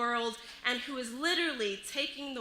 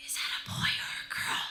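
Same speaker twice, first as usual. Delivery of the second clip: put-on child's whispered question; 4 dB quieter, faster than usual. Little girl asking the wife about me, whispered. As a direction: whisper it as a curious little kid's secret. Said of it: "she whispers".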